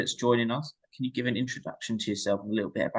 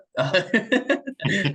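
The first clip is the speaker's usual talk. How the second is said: high burst of laughter; 9 semitones higher, 3 dB louder than usual. Real, not acted. Host laughs at FBI story.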